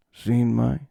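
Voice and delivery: understated fragment in a loner's voice; Nick Cage. Retold or imitated. Loner asking piece by piece, understated impression. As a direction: dole out the question haltingly. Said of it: "That's my Nick Cage impression".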